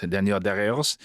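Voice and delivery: "Daniel Daggers" said with a French accent. Shown as a demonstration. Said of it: "impossible to say Daniel Dagger's name without saying it in a French accent".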